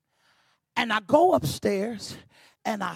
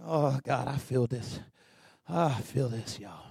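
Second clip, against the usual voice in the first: very low, soft aside; hushed, overcome; 7 semitones lower, 6 dB quieter, slower than usual. Real, not acted. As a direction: murmur it as if moved by the moment. Overcome after the preaching climax.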